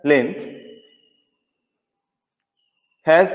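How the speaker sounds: slow, word-by-word dictating teacher; slow dictation. Acted. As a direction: spell it out slowly for note-taking. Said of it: "আমি dictate করছি".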